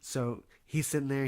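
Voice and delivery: normal talking voice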